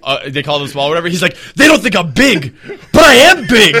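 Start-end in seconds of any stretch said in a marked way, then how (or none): 1.6-3.7 loud, rising to a high boast; hammed-up indignation breaking into laughter; mock indignant protest. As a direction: imitate an insistent protest, building louder. Acts out Sammy's protest.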